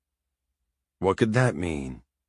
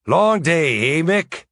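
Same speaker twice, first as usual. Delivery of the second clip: higher, louder Irish priest Seamus; old Irish priest voice; 8 semitones higher, 4 dB louder, slower than usual. A narrator's reading. Narrator voicing the priest as he bursts in, higher. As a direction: bluster in like a flustered old priest.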